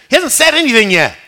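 loud, high burst of disbelief; incredulous objection. Acted. Preacher questions the prayer before the congregation, incredulous objection. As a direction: interrupt yourself, voice jumping up loud.

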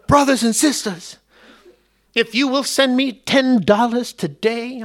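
put-on TV preacher in a high, drawn-out southern accent; hammed-up southern televangelist pitch. TV preacher asks viewers for money, mock southern accent. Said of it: "always have a southern accent".

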